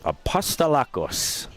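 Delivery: a light Greek accent on Apostolakos; lightly Greek Apostolakos, said quietly. Shown as a demonstration. Commentator obliges co-host's request, a hint of Greek accent. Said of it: "Start rolling your R's".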